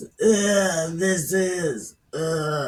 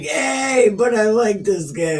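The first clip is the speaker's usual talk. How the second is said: much higher and louder; eager pleading; 5 semitones higher, 5 dB louder than usual. Retold or imitated. Absalom pleading with the king, high and loud. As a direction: bounce up eagerly. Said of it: "Absalom was like".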